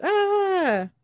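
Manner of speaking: high mock cry of pain; tasered cry. Acts Randy being tasered.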